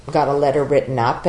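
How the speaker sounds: usual speaking voice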